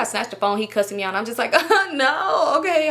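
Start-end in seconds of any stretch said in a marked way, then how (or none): 1.5-2.9 raised, fake-amused brush-off; playing it cool. Covering while he cusses her out, pretend amusement. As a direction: brush it off as if it's funny. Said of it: "I would play it so cool".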